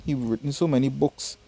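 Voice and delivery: usual speaking voice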